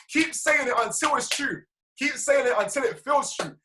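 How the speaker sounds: insistent, drawn-out "Keep saying it until", high and deliberate; emphatic urging. Real, not acted. Coach urging listeners to repeat affirmation.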